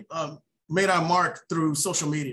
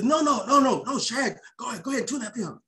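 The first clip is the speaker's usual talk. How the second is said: put-on old man brushing off; 4 semitones higher, faster than usual. Mister Tucker to the tuner, a high fading brush-off. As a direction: wave it off while choking up. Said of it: "And he says".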